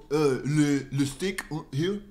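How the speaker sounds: ordinary talk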